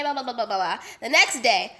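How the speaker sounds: ordinary talk, nothing marked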